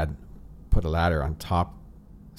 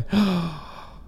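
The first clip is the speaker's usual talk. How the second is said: mock gasp; 13 semitones higher than usual. Retelling dad breaking his bow.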